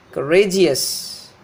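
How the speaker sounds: courageous slowly as cuh-RAY-jus; stress before suffix. Shown as a demonstration. Teacher showing suffix shift, courageous stressed on RA. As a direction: stretch RA in courageous. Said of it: "syllable that is before this suffix should be stressed".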